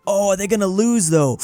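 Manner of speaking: high, loud, excited suspense; way too excited. Real, not acted. Game player fearing a sudden loss, excited. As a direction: raise the pitch and volume, caught up in it. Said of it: "I'm getting way too excited".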